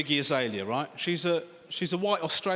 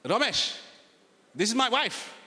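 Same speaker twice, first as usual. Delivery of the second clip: put-on higher-pitched uncle with Sri Lankan accent; 5 semitones higher than usual. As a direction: introduce her in an imitated Sri Lankan accent. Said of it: "and then he goes".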